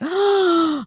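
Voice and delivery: sharp retold gasp of shock; a quick startled intake of breath. Gasps as the family realizes what he knows. Family amazed at child's knowledge.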